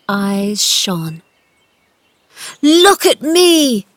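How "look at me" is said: high, loud, slow cry; triumphant shout. A narrator's reading. Boy standing up before the gardener, shouting. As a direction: shout it proudly, stretched out. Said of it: "he shouted up at Ben".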